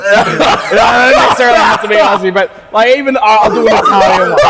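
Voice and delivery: laughing loudly while talking; words spilling out through laughter. Real, not acted.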